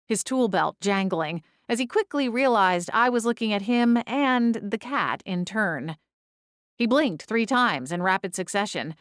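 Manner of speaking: ordinary delivery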